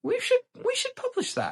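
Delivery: very high, stunned voice; sudden high squeak. A narrator's reading. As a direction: squeak it in amazement. Both bards say together.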